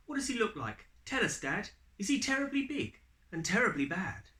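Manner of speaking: put-on high, quick and breathless child voice; little one pestering her dad for details; eager child. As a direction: rattle off the questions like an excited kid.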